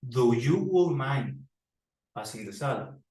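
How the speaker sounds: drawn-out mistake; slow wrong example. Shown as a demonstration. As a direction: stretch out the incorrect form. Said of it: "Y no dice".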